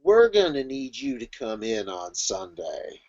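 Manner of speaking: low, soft, slow office drawl; droning boss. Acted. Boss asking worker in on Sunday, droning mock casual. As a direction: drag it out low and unhurried. Acted boss demanding weekend work.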